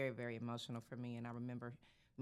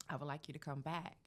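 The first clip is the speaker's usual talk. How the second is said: usual speaking voice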